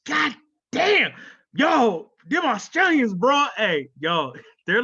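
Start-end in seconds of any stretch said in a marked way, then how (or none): 0.1-3.5 loud and high, hyped up; loud, high excited praise; excited shout. Real, not acted. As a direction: shout it like you're amped about them.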